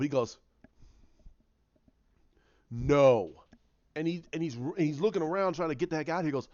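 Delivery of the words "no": flat refusal, punched louder; a blunt, louder no from a brooding Kylo; curt loud no. Retold or imitated. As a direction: slam the door on her request with one word. Kylo curtly refuses Rey's plea.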